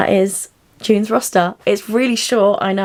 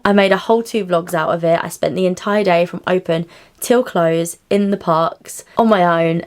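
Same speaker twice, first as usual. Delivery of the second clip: ordinary talk, nothing marked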